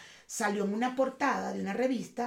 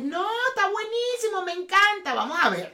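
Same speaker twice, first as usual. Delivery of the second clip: a light, higher mock of the ladies' gushing approval; older listeners telling the hosts they love the episode; older ladies approving; 8 semitones higher, 4 dB louder than usual. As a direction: gush like a pleased middle-aged lady. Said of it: "que van a decir".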